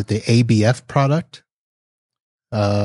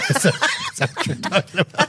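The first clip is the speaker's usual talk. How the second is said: laughing; 8 semitones higher, faster than usual. Real, not acted. Laughing at the acronym joke.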